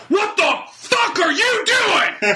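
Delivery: high, slow, laughing mock yell; angry driver shout. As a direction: bellow at the kids like a furious driver. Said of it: "and he goes".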